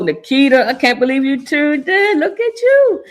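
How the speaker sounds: rising high and bright with real surprise; delighted surprise. Real, not acted. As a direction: greet a friend who showed up unexpectedly. Surprised to see a listener.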